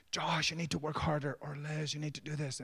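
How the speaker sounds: lightly acted high-pitched nagging; a hint of a hectoring coach; pushy coach dad. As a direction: get on the kids' case, imitated. Coach pushing his own kids.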